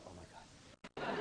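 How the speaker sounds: student whispering in dread about being called up; whispered dread. Retold or imitated. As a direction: breathe it out in mock horror. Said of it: "aren't you like".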